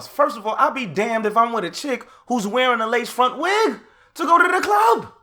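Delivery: rising to a loud, high yell; outraged shout; indignant shout. Real, not acted. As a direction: erupt at the idea of it. Ranting, shout heard.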